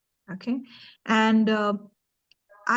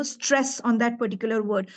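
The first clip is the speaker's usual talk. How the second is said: normal talking voice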